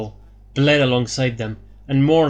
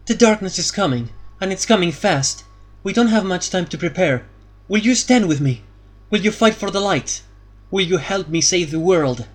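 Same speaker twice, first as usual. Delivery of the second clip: higher, pressing plea; urgent; 7 semitones higher than usual. A narrator's reading. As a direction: urge them to stand and fight. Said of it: "her voice growing more urgent".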